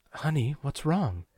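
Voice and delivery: high, slow motherly concern. A narrator's reading. Mother hearing trouble in caller's tone, concerned. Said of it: "heard something in my tone".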